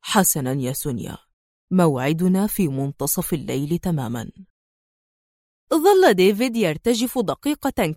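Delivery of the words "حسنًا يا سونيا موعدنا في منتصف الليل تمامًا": very low, cold, controlled agreement; icy. A narrator's reading. Adham accepting Sonia's midnight meeting, ice-cold. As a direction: agree coldly, giving nothing away. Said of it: "وهو يقول في برود".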